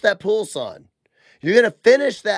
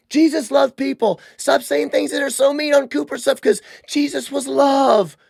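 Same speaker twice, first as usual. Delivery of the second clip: whiny parody pitched higher; mock whiny critic; 7 semitones higher than usual. Host mimics critics scolding him for being mean, whiny.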